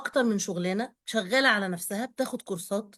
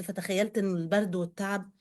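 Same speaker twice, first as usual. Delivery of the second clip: ordinary delivery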